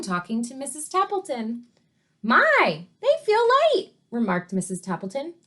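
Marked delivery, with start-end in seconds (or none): 2.2-3.9 high, loud "they feel light"; surprised wife. Acted. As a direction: sound amazed at how light. Wife voiced in story.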